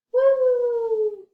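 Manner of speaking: loud, high whoop after the hush; excited whoop. Acted. Librarian whooping as the jack springs up. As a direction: burst out of the whisper with a cheer.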